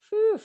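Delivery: high, sharp exhale of relief; mock relief sigh; relieved whew. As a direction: blow out the tension. Relief after traffic clears.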